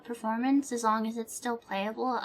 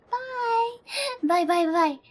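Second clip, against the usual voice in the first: bright goodbyes; 8 semitones higher, 3 dB louder than usual. Real, not acted. Cheerful goodbyes.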